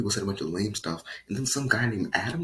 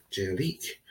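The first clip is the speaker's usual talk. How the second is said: ordinary delivery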